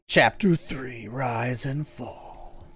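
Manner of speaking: slow, announcing the chapter; dramatic title read. Real, not acted. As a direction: read it like a trailer.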